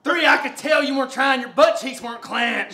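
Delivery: loud, high coaching shout; hard-driving coach. Real, not acted. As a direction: holler the count and rebuke at full volume. Coach counting and scolding effort.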